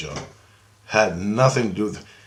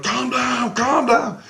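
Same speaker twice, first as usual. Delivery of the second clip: much higher, drawn-up voice; imitated soothing plea; 12 semitones higher, slower than usual. Christ tries to settle the yelling dreamer, high imitated plea.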